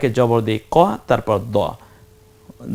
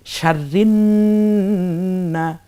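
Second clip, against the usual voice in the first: slow, drawn-out chanted recitation; Quranic recitation; 6 semitones higher, much slower than usual. Shown as a demonstration. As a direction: intone each word slowly like tajweed reading. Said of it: "teacher recites Quran verse".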